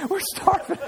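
high-pitched hammed-up child's whine, laughing; mock a hungry kid complaining; pleading kids. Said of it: "my kids would be like".

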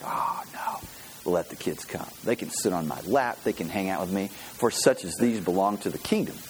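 low, softened reassuring voice; gentle welcome. Acted. Jesus gently welcoming the children.

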